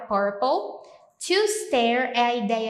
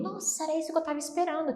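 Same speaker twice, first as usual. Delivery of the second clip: surprised; 6 semitones higher, 6 dB quieter, faster than usual. Acted. Acted surprise example.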